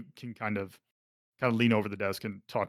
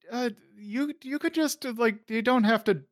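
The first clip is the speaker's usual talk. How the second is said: flustered receptionist; 10 semitones higher than usual. Acted.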